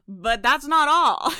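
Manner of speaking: slow, booming pitchman; loud cheesy sales pitch; salesman voice. Pretend. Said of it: "You guys like my salesman voice?".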